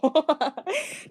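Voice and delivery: short amused burst of laughter. Real, not acted. Admitting to mocking people, amused laugh.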